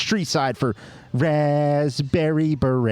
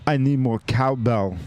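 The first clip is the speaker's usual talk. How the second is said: I Need More Cowbell as a famous quote, punchy; movie-line delivery. Shown as a demonstration. Host redoes beer name as the famous sketch line. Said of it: "Or hold on".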